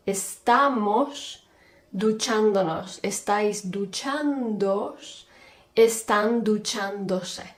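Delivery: slow, drawn-out endings for learners; slow teacher drill; slow conjugation drill. Shown as a demonstration. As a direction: lay out each form slowly. Teacher conjugates slowly, drawing endings.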